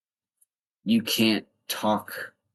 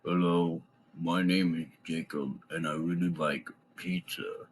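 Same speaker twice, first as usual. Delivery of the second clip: mock movie-villain deep voice, softened; hammed-up low voice, sinking quieter toward the end; overdone deep voice; 5 dB quieter than usual. Shown as a demonstration. Vlogger showing what not to practice, overdone deep voice. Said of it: "I don't mean you have to be like".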